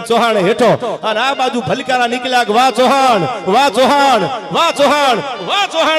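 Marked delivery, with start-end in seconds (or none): 2.5-6.0 loud cheering. Acted. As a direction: cheer like a roaring crowd. Crowd cheering the hero. (જોરથી વાહવાહીના પોકાર)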